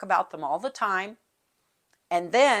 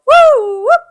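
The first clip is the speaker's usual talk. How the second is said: very high, very loud piercing woo whoop; woo whoop call; 21 dB louder than usual. Shown as a demonstration. Teachers calling the whole school to attention, piercing high whoop. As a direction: pierce through the room with it. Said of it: "really high".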